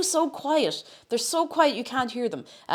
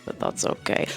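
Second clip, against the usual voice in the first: whispered aside; whispering; 5 dB quieter than usual. Real, not acted. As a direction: drop to a whisper. Breathy aside.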